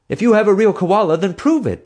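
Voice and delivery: high, loud order. A narrator's reading. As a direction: order him to prove it. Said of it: "the groom ordered".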